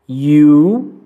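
"You" said with a closed oo sound; closed vowel. Shown as a demonstration. Teacher demonstrates the closed vowel in you. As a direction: keep "You" tight and closed. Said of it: "som fechado".

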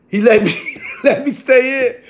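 amused, chuckle mid-phrase; laughing. Real, not acted. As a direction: laugh breaking into the sentence. Laughs while telling outcome.

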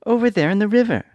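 put-on high little girl voice; worried girl asking. A narrator's reading. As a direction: plead as young Dorothy. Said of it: "answered the girl".

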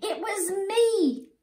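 high small voice pleading; squeaky little warthog. Acted. Ngiri confessing to everyone, high squeaky voice. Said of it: "in a squeaky voice".

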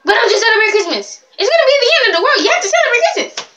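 high, rushed end-of-the-world panic imitation; hammed-up frantic mimicry, fast and high; mock an overdramatic person, climbing in pitch; hysterical mock questioner. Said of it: "maybe not that bad, but, like, they be like".

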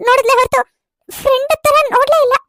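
normal talking voice

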